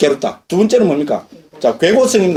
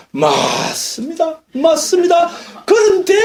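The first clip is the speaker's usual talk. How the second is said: sly, smug agreement; 9 semitones higher, much slower than usual. Acted. Teacher acting out his slippery answer to a debate opponent, sly. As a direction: agree slyly, stretch it, then pitch the comeback way up. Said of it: "더 능글능글하고요".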